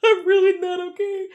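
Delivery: high-pitched panicky answer; freaked out. Retold or imitated. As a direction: insist you are not okay, rattled. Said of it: "And I'm like".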